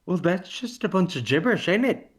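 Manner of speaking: a cheeky high Welsh jab at the language; mock Welsh accent. Asked for a Welsh accent, he answers high and fast in mock Welsh. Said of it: "Can you do a Welsh accent?".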